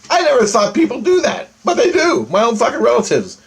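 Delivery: high-pitched, indignant outburst; real outrage. Real, not acted. Man venting about relatives who screwed him, real outrage.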